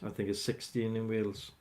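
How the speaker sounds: ordinary delivery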